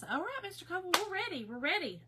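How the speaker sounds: attendant calling. Retold or imitated. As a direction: mimic a helper announcing readiness, lifted voice. Retelling the wheelchair attendant's call.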